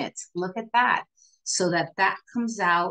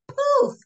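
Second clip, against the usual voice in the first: very high sound-effect poof; playful poof; 6 dB louder than usual. Shown as a demonstration. Teacher showing how the inner eye stands out, high playful poof. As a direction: pop out a tiny, high poof.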